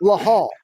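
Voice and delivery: "Blahal" said BLAH-hal; imitating Chael Sonnen. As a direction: mimic the mangled "Blahal". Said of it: "He calls him".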